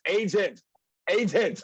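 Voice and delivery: retold phone yell. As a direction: call out to an automated phone line. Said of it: "I'm yelling in the phone".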